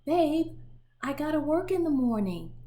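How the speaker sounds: loud, high, fast call from bed; mother shouting. Acted. Mother shouting at Libba to stop playing at night. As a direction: shout it down the hall, tired. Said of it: "her mother would shout".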